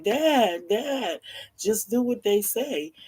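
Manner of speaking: pleading sons. Retold or imitated. Kidnapped sons call to their father from the background, pleading. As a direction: call out high for Dad, imploring. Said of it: "the sons are in the background".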